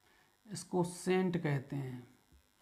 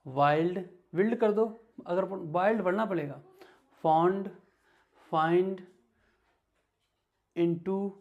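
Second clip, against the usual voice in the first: louder, each word called out clearly; read-along drill; 2 semitones higher, 5 dB louder than usual. Acted. Teacher leads the class reading word list aloud. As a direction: call out each word for students to repeat.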